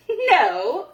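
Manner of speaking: a little mouse crying out; cried out. A narrator's reading. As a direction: pipe up from behind the tree. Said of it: "cried Little Mouse".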